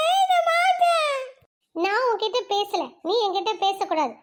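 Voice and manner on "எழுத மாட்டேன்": high, slow shouted refusal; screaming bratty kid. Acted. Child shouts at teacher that she won't write. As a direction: yell it like a tantrum child.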